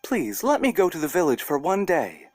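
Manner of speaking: put-on high little girl voice, sweetly pleading; a small girl coaxing a bear to let her go; little girl Masha. Masha begging the bear to visit grandparents, high sweet girl voice. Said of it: "and said to him".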